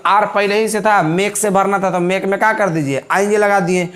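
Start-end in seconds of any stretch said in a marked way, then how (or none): none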